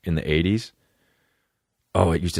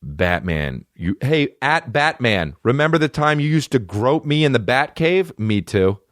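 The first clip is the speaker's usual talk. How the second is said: mocking Robin; 7 semitones higher than usual. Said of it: "he'd be like".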